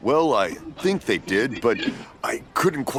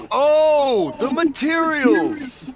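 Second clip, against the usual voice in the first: sudden realization; 10 semitones higher, much slower than usual. Acted. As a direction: finally get it, pitch leaping up. Deaf father finally understands.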